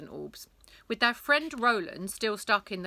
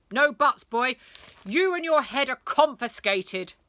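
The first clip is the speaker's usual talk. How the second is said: loud, higher barked order; much louder stern schoolmaster; stern teacher; 5 semitones higher, 9 dB louder than usual. Acted. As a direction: hand down the sentence. Teacher confiscating the boy.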